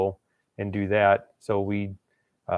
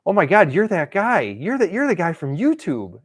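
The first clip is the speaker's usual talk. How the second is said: high, louder starstruck gush; super excited fan; 8 semitones higher, 5 dB louder than usual. Retold or imitated. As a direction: gush like you just met your hero. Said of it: "he was super excited".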